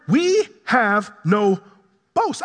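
"We have no boast" drawn out slowly; emphatic declaration. Real, not acted. Preacher driving home the key line to congregation, emphatic. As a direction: land "We have no boast" one slow word at a time.

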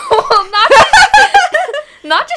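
loud, high laughter breaking up the words; bursting out laughing. Real, not acted. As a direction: try to object through a big laugh. Bursts out laughing at co-worker's remark.

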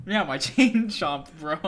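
laughing high and loud. Real, not acted. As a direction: laugh through the words. Laughing at misheard word.

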